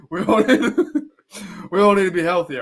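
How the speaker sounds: high-pitched, broken up by real laughter; laughing through words. Real, not acted. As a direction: try to say it while cracking up. Laughing at his own hypocrisy.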